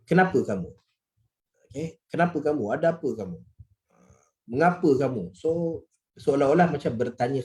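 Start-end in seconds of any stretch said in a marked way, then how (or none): none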